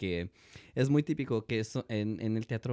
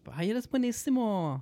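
gushing praise; 12 semitones higher, slower than usual. Retold or imitated. Imitating praising adults.

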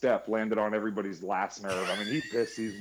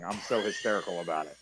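ordinary talk

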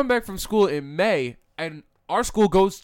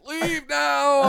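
higher-pitched mock-presidential order; Biden impression; 6 semitones higher than usual. Biden caught in the Oval Office, mock presidential voice. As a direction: play an interrupted president, pitched up. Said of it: "Mister Biden".

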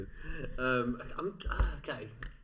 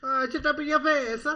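high-pitched put-on Indian accent; hammed-up Indian accent; mock Indian accent; 12 semitones higher, 4 dB louder than usual. Joins in the Indian accent attempt.

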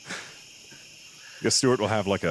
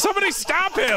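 shrill panicked cry for help; panicked high shout. Acted. Stuart panicking in character.

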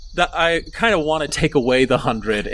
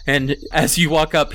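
normal talking voice

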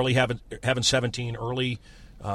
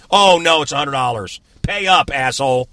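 mock cop; 8 dB louder than usual. Mock cop demanding payment.

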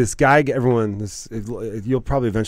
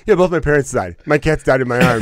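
imitating deadpan answer; 4 semitones higher, 7 dB louder than usual. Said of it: "one of the funniest responses".

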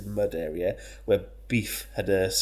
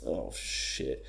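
sheepish; 8 semitones lower, 7 dB quieter than usual. Retold or imitated. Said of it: "he was like".

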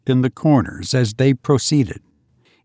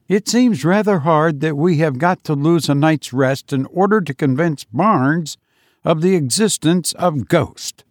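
higher-pitched voice of a reluctant companion; 4 semitones higher than usual. Acted. Reluctant man to his friends on a night walk to a haunted house, grumbling. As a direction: complain lightly in a raised-pitch voice.

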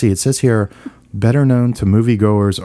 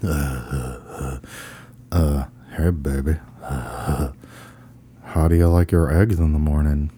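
low, soft boozy pickup line; slurry low drunk come-on with halting uhs; drunken bar customer; 5 semitones lower, 5 dB quieter, slower than usual. Pretend. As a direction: play a drunk hitting on someone at the bar. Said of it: "play the part of the drunken customer at the bar".

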